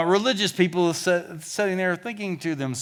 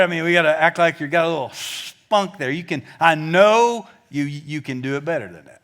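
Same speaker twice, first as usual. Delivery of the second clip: ordinary delivery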